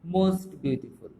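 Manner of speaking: very slow, every syllable stretched; a teacher dictating "most beautiful" at a crawl; drawn-out model phrase. Shown as a demonstration. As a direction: draw "most beautiful" out slowly for the class. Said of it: "সুপারলেটিভে বসবে".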